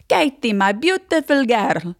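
high, slow Greek uncle, slightly Italian; drawn-out, doting Greek-accented greeting. Retold or imitated. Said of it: "I have my Greek uncle".

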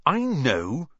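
short irritated snap; snapping irritably. Acted. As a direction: snap back at her. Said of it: "Ron snapped".

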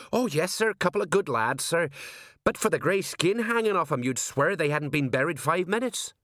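high-pitched, dutiful underling voice; high, respectful sergeant reporting; eager deferential sergeant. A narrator's reading. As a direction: report to the boss, voice pitched well up. Sergeant's character voice.

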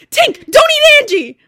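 high, loud, slow panicked yell; retelling friend's panicked yell at dog; panicked scream. Retold or imitated. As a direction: shout it in panic. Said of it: "the panic in your voice".

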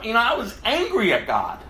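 usual speaking voice

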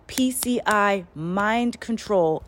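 drawn out word by word; slow deliberate reading. Retold or imitated. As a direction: read it very slowly.

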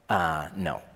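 low, dry, dismissive no; flat refusal. Retold or imitated. Fisherman turning down a stranger's advice, dismissive. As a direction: shut it down in two words. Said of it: "He'd be like".

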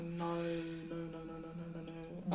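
soft, fading string of no's; soft despairing. Retold or imitated. Retelling realising her bag was gone, soft despair. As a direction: sink into quiet despair.

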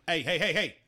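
loud high scolding quote; quoting the werewolf. Retold or imitated. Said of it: "He's like".

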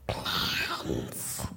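barely audible breathy whisper; eerie whisper. Acted. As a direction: breathe the word like a secret. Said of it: "Sorry, what was that?".

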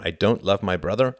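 ordinary talk

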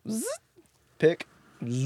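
buzzing zipper noise; zipping sound. Shown as a demonstration. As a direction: zip it up with a buzz. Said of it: "your zipper noise".

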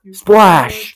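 big, high shouted splash; loud sound effect. Pretend. Pretend fishing for podcast audio, shouted splash effect.

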